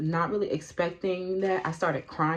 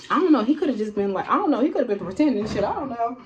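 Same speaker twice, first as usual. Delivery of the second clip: rushed doubt; 5 semitones higher, 6 dB louder, faster than usual. Real, not acted. Doubting his sincerity.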